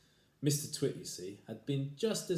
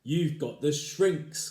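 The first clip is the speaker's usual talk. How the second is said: slow, louder menacing cry; crying husband; 6 semitones higher, 10 dB louder, much slower than usual. Acted. Husband crying at his wife, slow and loud. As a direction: drag it out like an accusation. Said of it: "cried Mister Twit".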